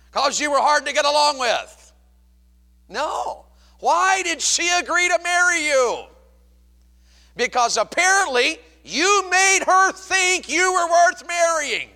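high, loud, drawn-out preacher's cry; loud and high, stretching the key words; full-force preaching. Real, not acted. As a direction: belt it out to the congregation. Preacher shouting his point.

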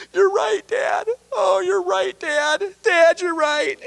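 high, tearful and breaking; weeping son. Acted. Elder brother weeping to his father, high and tearful. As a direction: sob out an apology to your father. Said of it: "He's weeping".

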